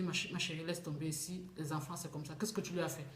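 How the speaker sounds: soft, low motherly reassurance; retold soothing. Mother soothes upset daughter, retold. (mère qui apaise, voix basse et douce)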